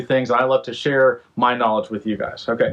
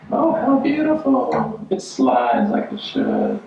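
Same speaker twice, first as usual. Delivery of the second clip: mock admiring; 12 semitones higher, slower than usual. Admiring the new shower door, slow put-on swoon. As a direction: coo over it like a showroom host.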